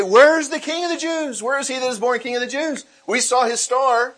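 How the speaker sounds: high, loud, eager travellers asking for the king; eager magi asking. Retold or imitated. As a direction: call out like excited foreigners searching for a king. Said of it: "and they say".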